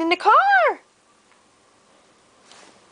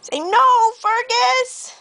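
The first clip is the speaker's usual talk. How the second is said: scolding call; 7 semitones higher, slower than usual. Acted. Reading the story's scolding line.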